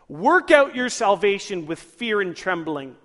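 measured, weighty quotation; deliberate scripture quote. Acted. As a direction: proclaim the verse slowly and firmly. Reads the verse as written.

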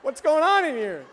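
a man jumping off the toilet in shock; startled bystander. Retold or imitated. Washroom stranger startled by shouting, alarmed yell. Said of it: "people will jump up off the toilets like".

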